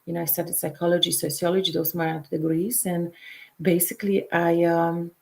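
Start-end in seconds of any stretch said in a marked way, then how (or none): none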